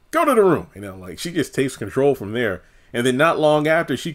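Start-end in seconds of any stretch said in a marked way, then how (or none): 0.1-0.7 much higher commanding "Go to the room"; higher bossy repeat. Retold or imitated. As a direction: order "Go to the room" again, higher. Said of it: "It's like".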